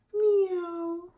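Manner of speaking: high, loud, drawn-out meow; cat meowing. A narrator's reading. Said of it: "said Cat".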